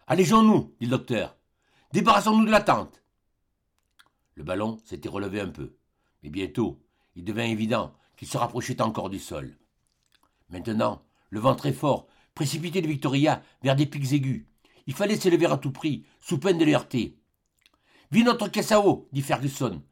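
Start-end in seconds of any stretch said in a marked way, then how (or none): 0.1-0.7 raised, urgent call to lighten the load; urgent, higher and louder command; urgent order. Acted. Doctor urges lightening balloon. 1.9-3.0 raised, pressing command; urgent, higher order to dump the tent. Acted. Doctor urges lightening balloon. 18.1-19.1 higher, pressing order; raised voice ordering the water out; urgent command. Acted. Ferguson orders water dumped.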